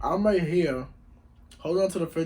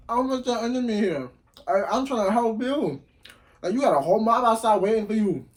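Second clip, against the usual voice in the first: raised, higher-pitched appeal; urgent pleading; 7 semitones higher, 8 dB louder than usual. Retold or imitated. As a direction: plead loudly to get through to a worked-up friend. Urgent plea to calm friend.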